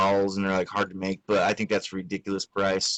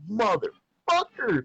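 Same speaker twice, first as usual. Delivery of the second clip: exasperated curse; 10 semitones higher, much slower than usual. Retold or imitated. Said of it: "And I was like".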